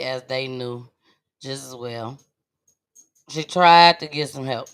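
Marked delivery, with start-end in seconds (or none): 3.3-4.7 mouth full. Real, not acted. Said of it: "y'all, I'm eating chips".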